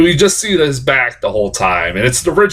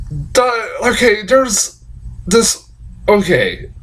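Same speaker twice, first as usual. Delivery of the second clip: ordinary talk, nothing marked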